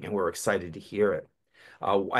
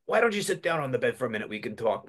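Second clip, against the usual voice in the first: usual speaking voice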